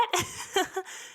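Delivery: high soft nervous laugh; quick uneasy giggle. Real, not acted. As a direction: laugh the tension away. Nervous laugh after hard topic.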